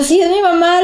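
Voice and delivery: high, loud desperate insistence; frantic daughter defending her mother; hysterical pleading. Retold or imitated. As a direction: beg them to take mom too. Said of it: "Yo estaba histérica".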